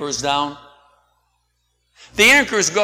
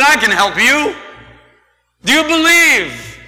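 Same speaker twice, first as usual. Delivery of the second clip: voice of God; 8 semitones higher, 6 dB louder than usual. A narrator's reading. God's voice from heaven.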